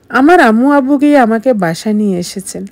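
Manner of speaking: ordinary delivery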